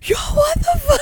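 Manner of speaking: high, loud, laughing outburst; mock-appalled yelp through laughter; imitated shock. Retelling his shocked reaction.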